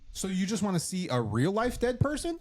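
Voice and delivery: high, sarcastic question; mock sarcastic. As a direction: needle them with a high voice. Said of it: "I'm like".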